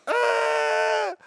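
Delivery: high shocked yelp; a sudden high squawk of shock; strangled yelp. Retold or imitated. Caught off guard by her offer, a high yelp. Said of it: "I'm like".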